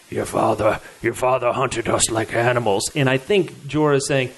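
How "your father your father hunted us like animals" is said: lower, heavy, lightly voiced; a low grudging growl of a line; Tormund's accusation. Tormund accusing Jorah over his father, low and gruff. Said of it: "Tormund said".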